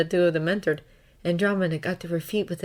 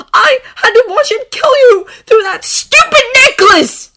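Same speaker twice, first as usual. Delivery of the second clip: high, very loud, tearful cry; pained shouting; 16 dB louder than usual. A narrator's reading. Draco reliving Harry's death, tearful screaming. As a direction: scream the grief at him. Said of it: "the pain was everywhere again".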